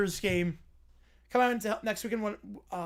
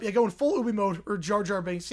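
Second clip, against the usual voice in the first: ordinary talk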